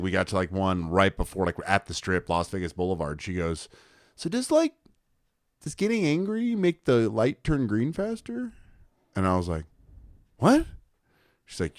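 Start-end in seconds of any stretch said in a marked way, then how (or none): 4.1-8.5 put-on high, mild old lady voice; grandma's question. Grandma gently asks angry driver. 10.4-10.7 high startled what; surprised. Retold or imitated. Grandson startled by grandma's question.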